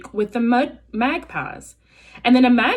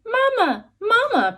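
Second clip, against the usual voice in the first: put-on very high, tiny baby-bird voice; tiny gosling peeping for mama; 14 semitones higher than usual. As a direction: squeak it like a newborn chick. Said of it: "peeped the gosling".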